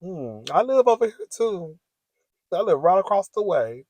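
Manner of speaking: a hint of sweet come-on; playful flirty invitation. Pretend invite to the new neighbor, lightly flirty.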